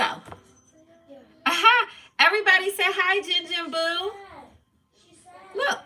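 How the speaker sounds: excited cat reveal. Real, not acted. Presenting the cat to viewers.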